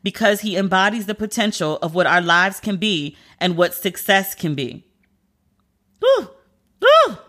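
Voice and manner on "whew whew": a high, breathy whew of being moved; high overwhelmed exhale; emotional whew. Real, not acted. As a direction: blow out a high whew, overcome. Overwhelmed by the video tribute.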